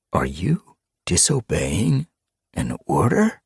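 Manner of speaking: slow, rising, pointed question; drawn-out, threatening challenge; menacing question. Acted. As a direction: test his loyalty slowly. Leader testing underling's loyalty.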